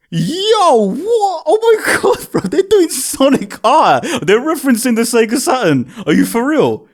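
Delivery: high-pitched, excited outburst retold; hyped disbelief. Fan reliving the Sonic R reference, hyped disbelief. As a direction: relive the shock, voice leaping up then easing down. Retelling his stunned reaction to concert.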